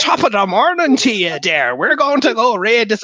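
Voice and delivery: high, loud, drawn-out stage Irish; mock an actor's terrible Irish brogue; slow, hammed-up fake Irish; horrible Irish accent. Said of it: "put on really horrible Irish accents".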